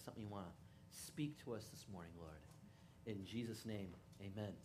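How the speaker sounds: hushed opening prayer to God. Acted. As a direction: pray it quietly and sincerely. Pastor prays before the message.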